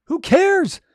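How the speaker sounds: loud, high who cares; emphatic shout. Real, not acted. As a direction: blast it out to shake off doubt. Coach urging authors past self-consciousness.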